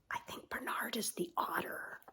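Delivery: soft whispered aside. Real, not acted. Aside to child while reading.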